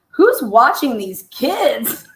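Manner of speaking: can't keep a straight face; laughing it out slowly. Real, not acted. Laughing at own thought.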